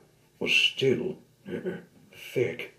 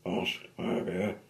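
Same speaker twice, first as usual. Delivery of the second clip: usual speaking voice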